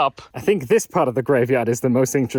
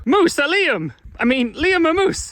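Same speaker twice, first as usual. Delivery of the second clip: very high, mock-excited exclamation; flustered calling out; 12 semitones higher than usual. Telling friend Liam about Canadian wildlife, words mixed up, flustered high call. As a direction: cry it out high, as if tripping over your words. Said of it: "you get all the words mixed up".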